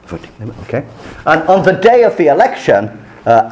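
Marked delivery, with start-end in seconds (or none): 0.0-0.8 quiet aside. Retold or imitated. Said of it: "you go".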